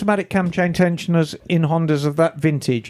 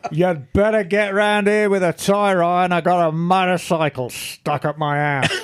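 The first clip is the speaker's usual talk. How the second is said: put-on a lightly done Australian accent, half laughing; Australian accent, barely there, giggling. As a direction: put on a hint of broad Aussie, laughing through it. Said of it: "it is more like".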